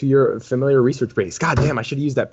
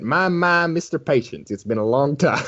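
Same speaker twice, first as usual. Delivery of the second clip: put-on slight southern accent; 4 semitones higher than usual. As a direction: greet an old friend in a mild Southern accent. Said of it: "He's talking with a slight southern accent".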